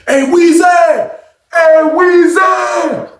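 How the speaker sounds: long drawn-out shout up the stairs; high, slow yelled name; calling out. Retold or imitated. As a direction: holler the name so it carries. Someone calling up from downstairs.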